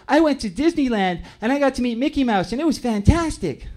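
put-on boy telling the class about Disneyland; little boy. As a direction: gush like an excited kindergartner.

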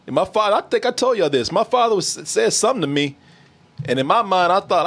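ordinary talk

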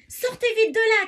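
loud, high, urgent cry; shouted order. A narrator's reading. Emma freeing those inside the wolf, shouting. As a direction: yell it out to get them moving. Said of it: "crie-t-elle".

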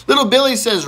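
normal talking voice

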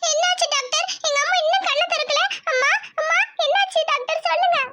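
put-on high child voice begging the doctor; desperate little girl's plea; pleading girl. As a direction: beg him to save her mother. Girl asks doctor anxiously.

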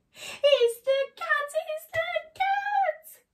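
very high, loud, drawn-out mouse squeal; panicked cry. Acted. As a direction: stretch out the cry in storybook terror. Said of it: "cried Squeak".

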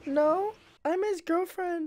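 high squeaky voice through a voice changer; voice changer girl voice. Acted. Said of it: "So I put on a voice changer".

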